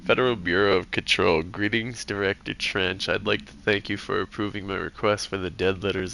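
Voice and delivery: even, measured reading of an official letter; composed official-letter narration; document read-aloud. Acted. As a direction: read the formal memo aloud, restrained and steady. Reads game document aloud.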